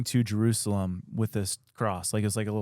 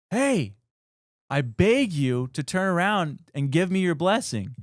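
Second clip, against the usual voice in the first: high pleading call across a river; 7 semitones higher than usual. Retold or imitated. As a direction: call out and beg from far away. Priest calling across the river.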